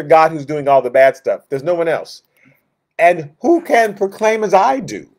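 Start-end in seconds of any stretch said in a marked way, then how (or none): 3.0-5.1 higher, louder preaching of scripture; fiery preaching. Acted. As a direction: proclaim it with force from the pulpit. Preaching scripture at full force.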